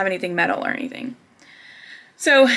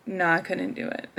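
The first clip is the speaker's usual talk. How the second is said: quiet tearful; 3 semitones lower, 9 dB quieter than usual. Retold or imitated. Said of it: "as I'm bawling".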